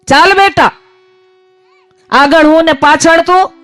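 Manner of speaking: put-on high-pitched summons to a child; divine father's call. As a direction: call the child along, voice lifted. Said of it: "આ બેઠો બેઠો એવું કહે છે".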